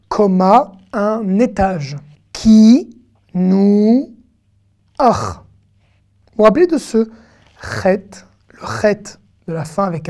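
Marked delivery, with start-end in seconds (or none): none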